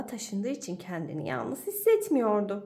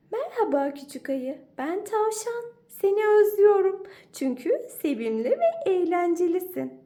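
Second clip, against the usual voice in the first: higher, half-sung friendly rabbit; rabbit's letter; 8 semitones higher, 5 dB louder than usual. Acted. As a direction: lilt it like a cheerful bunny.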